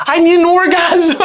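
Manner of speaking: can barely get the line out for laughing; laughing through the quote. Retold or imitated. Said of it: "He goes".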